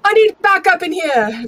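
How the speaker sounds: high, loud call for backup; real loud call. Acted. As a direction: shout so someone outside can hear. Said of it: "real loud, hoping that someone".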